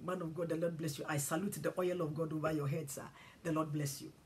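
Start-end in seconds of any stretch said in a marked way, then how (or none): none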